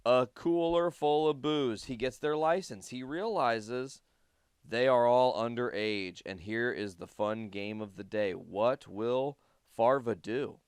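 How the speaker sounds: ordinary talk, nothing marked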